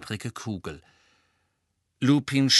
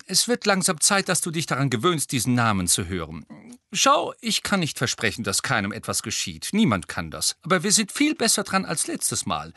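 firm, urgent reasoning; 7 semitones higher, 5 dB louder than usual. A narrator's reading. Lupin urging Molly to face facts.

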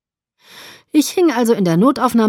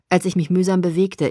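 ordinary delivery, nothing marked

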